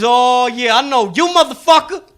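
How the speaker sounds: high, loud street con man building to a shout; slow right down for a shouted, triumphant guess; cocky hustler, drawn-out high yell; mixtape salesman. Acted. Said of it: "And he goes".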